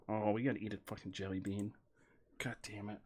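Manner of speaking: ordinary delivery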